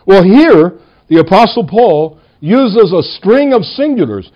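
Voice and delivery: ordinary talk, nothing marked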